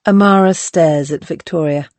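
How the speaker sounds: plain reading voice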